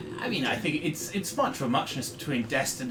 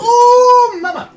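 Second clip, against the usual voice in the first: much higher, loud yelp; excited shout; 16 dB louder, much slower than usual. Real, not acted. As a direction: shout it out in delight. Reacting to a dice result.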